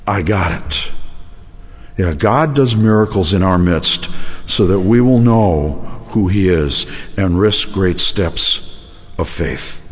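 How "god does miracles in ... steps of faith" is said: ordinary delivery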